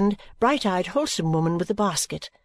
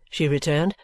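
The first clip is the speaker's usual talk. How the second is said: plain reading voice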